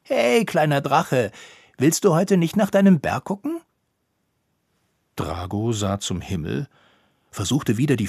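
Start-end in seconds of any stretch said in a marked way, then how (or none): 0.0-3.6 calling out. A narrator's reading. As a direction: call across to the little dragon. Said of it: "rief Drago zu".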